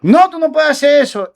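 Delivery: mock startled no; alarmed protest. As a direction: blurt it out in shock, as he said it. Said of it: "Dice él".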